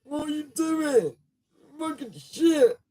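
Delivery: put-on very high squeaky outraged kid voice; hammed-up squeaky rage; raging kid impression. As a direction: screech like a furious young gamer. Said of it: "one of them kids that are just like".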